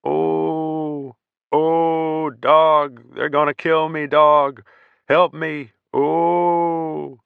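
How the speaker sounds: high, wailing moans of a panicked man; moaning in terror. Acted. Terrified soldier moaning for his dog, high wailing. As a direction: moan for help as if dying. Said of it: "heard someone start to moan".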